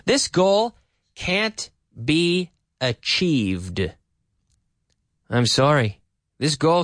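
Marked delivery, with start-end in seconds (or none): 0.0-3.9 word by word, with long gaps; slow dictation for learners; each word separately placed; very slow model sentence. Shown as a demonstration.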